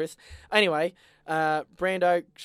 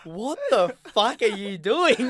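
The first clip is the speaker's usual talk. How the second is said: higher, slower, laughing mock outrage; 7 semitones higher, 5 dB louder, slower than usual. Voicing his mate's shocked look at him, laughing. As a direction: act out the mate's stunned glare. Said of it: "with a face as if to say".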